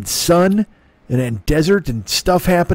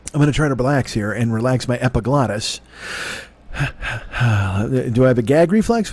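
ordinary talk

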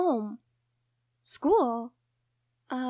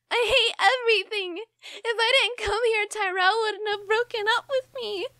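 tearful despair. Acted. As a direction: pour it out through tears. Breakdown in front of a friend.

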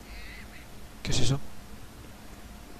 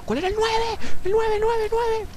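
very high panicked repetition; high-pitched frantic cry; 4 dB louder than usual. Real, not acted. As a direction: squeal it as you scramble. Frantically seeks weapon key under attack.

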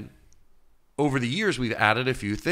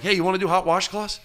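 higher, brighter eager call; eager invite; 11 semitones higher, 6 dB louder than usual. Retold or imitated. Inviting friends over, an eager higher call. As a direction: pitch the idea to friends eagerly. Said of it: "We're like".

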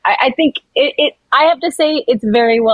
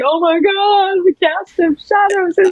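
excited fan; 9 semitones higher, slower than usual. Retold or imitated. Excited fan quote in story.

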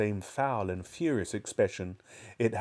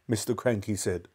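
ordinary delivery

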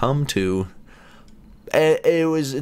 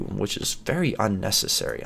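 ordinary talk

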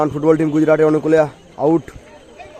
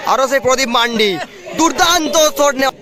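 excited; 7 semitones higher than usual. Real, not acted. Commentator builds on a shot.

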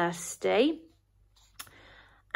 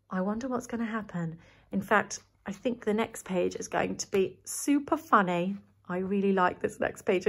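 hushed teaser; 4 semitones lower, 8 dB quieter, faster than usual. Real, not acted. Hushed build-up aside.

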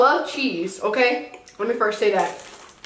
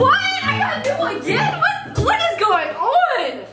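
laughing disbelief; 11 semitones higher than usual. Real, not acted. Has to try again, laughing.

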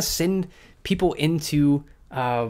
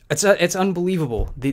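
usual speaking voice

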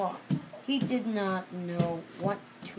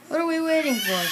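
loud, higher eager call; eager question; 7 semitones higher, 7 dB louder, faster than usual. Acted. Seal asks in line.

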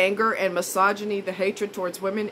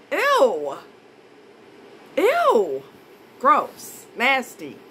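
disgusted ew; 3 semitones higher, 4 dB louder, much slower than usual. Real, not acted. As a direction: squeal it out, repulsed. Disgust interjection.

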